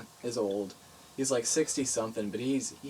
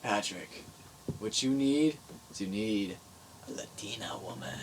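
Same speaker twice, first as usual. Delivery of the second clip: grandpa's whisper; 3 semitones lower, 4 dB quieter than usual. Retold or imitated. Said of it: "he looks at me, he's like".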